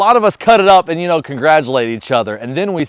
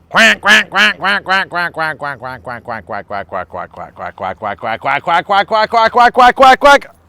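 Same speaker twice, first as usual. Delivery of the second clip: duck quacking; slower than usual. Shown as a demonstration. Quacking like a duck to tempt the dog, fading then building. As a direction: quack like a duck, long and uneven. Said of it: "I'm gonna quack like a duck".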